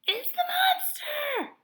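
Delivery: high, loud, drawn-out shriek of alarm; screech. A narrator's reading. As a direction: scream it in terror.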